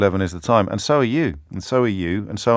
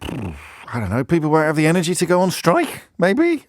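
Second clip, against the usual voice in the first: higher, pompous columnist voice; mock Daily Mail columnist; 8 semitones higher than usual. Pitching a Daily Mail column in a higher, smug parody voice. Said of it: "What else we got?".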